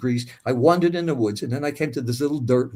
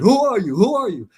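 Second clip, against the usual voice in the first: demanding soldier; 8 semitones higher, 6 dB louder than usual. Retold or imitated. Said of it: "He said".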